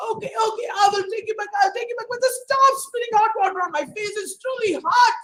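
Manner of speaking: high, fast pleading robber; begging. Acted. Robber begging the teapot to stop, high and fast. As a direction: beg him to stop it. Robber begs under hot water.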